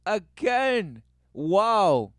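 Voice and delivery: loud high cry of disbelief; real startled shout; excited shout. Real, not acted. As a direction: yell it out at the scare. Reacting to repeated scare.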